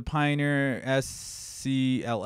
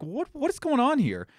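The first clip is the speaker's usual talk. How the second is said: higher-pitched, incredulous demand; retold indignant bafflement; 12 semitones higher than usual. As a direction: question the courier in disbelief, pitch jumping up. Retold baffled call to FedEx.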